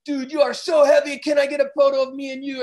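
high, loud imitation of the fan; excited fan. Beachgoer fan asking the surfer for a photo, loud and high. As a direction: gush over him like a starstruck fan. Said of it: "some South American guy".

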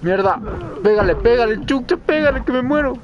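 high, loud desperate shouting; panicked yelling. Real, not acted. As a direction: yell for help in a panic. Pelea desesperada en el juego. (gritos desesperados)

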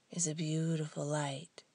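ordinary talk

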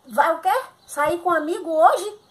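high, loud indignant cry; outraged disbelief. Acted. Pregnant wife on the phone hearing her husband is going out, outraged loud disbelief. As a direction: shriek it back at him in disbelief.

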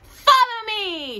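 high, loud and drawn out call; cried out. A narrator's reading. Raggedy Ann leading the dolls across the floor, high and loud. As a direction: lead the charge. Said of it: "she cried".

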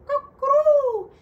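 high, loud cooing; dove coo. Acted. Doves coo imitated.

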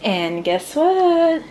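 slow, high, drawn-out reveal; drawn-out announcement. Acted. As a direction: stretch it out like a big reveal. Announcing cooking is done.

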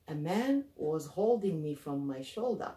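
ordinary delivery, nothing marked

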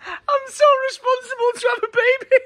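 very high, squeaky laughing voice; helpless laughter. Real, not acted. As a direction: squeal it out through real laughter. Joking, overcome with laughter.

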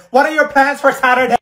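high, loud mimicking jeer; mocking imitation. Student imitating loudest classmate, mocking loud voice. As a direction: throw her words back loudly in mockery. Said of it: "You were the loudest one".

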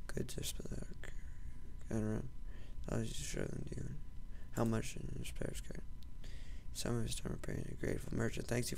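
very soft, low mumble; barely voiced reading to yourself; reading under breath. Real, not acted. Gamer reading a merchant's card to himself, murmured.

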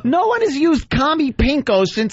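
high-pitched disbelief, easing down toward the end; incredulous outburst. Real, not acted. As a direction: cry out in amused disbelief at the dated phrase. Incredulous at the dated insult.

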